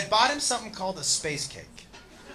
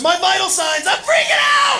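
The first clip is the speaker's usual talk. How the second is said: high, loud frantic shout; panicking brother; 12 semitones higher, 7 dB louder than usual. Retold or imitated. Brother at the blood pressure machine, frantic shout. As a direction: yell it in full panic.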